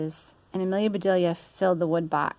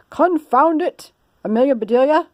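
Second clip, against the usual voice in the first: gruff Mister Rogers; 8 semitones higher, 6 dB louder than usual. Acted. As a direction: bark it like a fussy old man. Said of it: "he said".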